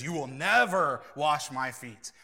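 NEVER punched loud in a slow refusal; aggressive refusal. Acted. Peter refuses to let Jesus wash his feet, aggressive stress on NEVER. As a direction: come down hard on "never". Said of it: "he's very aggressive about it".

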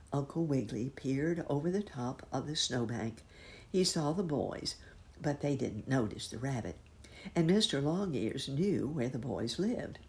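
ordinary delivery, nothing marked

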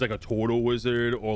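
normal talking voice

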